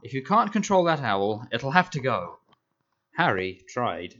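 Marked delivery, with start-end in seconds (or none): 0.0-2.4 loud, higher blustering uncle voice; a furious uncle laying down the law; angry uncle. A narrator's reading. Uncle threatening to get rid of the owl, loud bluster. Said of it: "he roared across the table".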